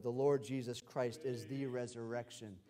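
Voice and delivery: ordinary delivery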